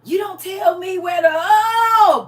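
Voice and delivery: high, loud yelling cry; crying shout as he falls. Acted. Stubborn man yelling as he tumbles off the cliff. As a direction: wail as you go over the edge.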